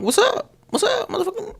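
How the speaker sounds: very high, squeaky, hammed-up greeting; grumpy morning greeting. Girlfriend who woke up on the wrong side of the bed, very high and squeaky. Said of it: "you talking, like".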